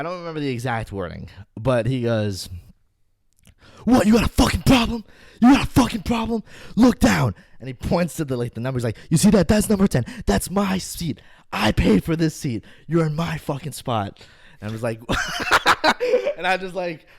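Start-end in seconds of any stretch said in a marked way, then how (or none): 3.8-7.3 loud, high-pitched aggressive challenge; angry confrontation. Retold or imitated. Furious fan to the man in his seat, confrontational. 9.1-12.6 loud, raised-pitch indignant claim; angry confrontation. Retold or imitated. Furious fan claims his paid seat, angry. 12.9-14.2 loud, raised voice staking a claim; angry accusation. Retold or imitated. Furious fan to the man in his spot, angry.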